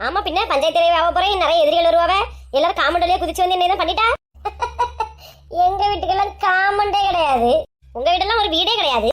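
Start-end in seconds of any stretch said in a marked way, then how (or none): 4.4-5.1 short laugh. Acted. Boasting about becoming panchayat head.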